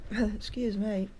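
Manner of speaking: ordinary talk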